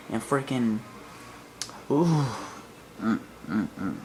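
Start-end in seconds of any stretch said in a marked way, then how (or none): none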